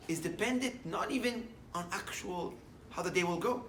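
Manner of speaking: normal talking voice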